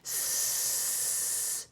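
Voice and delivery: long hissed S for the class; stretched S. Shown as a demonstration. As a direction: hiss the S slowly so kids copy. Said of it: "Make it with me".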